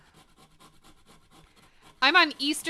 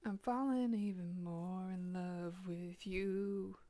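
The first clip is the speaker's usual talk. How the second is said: soft, slow and hushed, almost swooning; murmured like a love confession; tender murmur; 4 semitones lower, 15 dB quieter, slower than usual. Real, not acted. Cooing to the coleslaw she's making, soft and slow.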